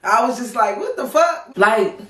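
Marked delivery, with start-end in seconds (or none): 0.8-1.5 incredulous. Retold or imitated. Reacting to his wimpy ask.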